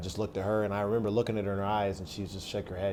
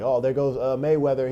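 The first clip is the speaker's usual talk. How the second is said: imitating a teasing inmate, a bit higher and louder; mocking praise; 5 semitones higher, 7 dB louder than usual. A teasing inmate hailing him after the fight, mock praise. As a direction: lightly hype him up like a taunting fan.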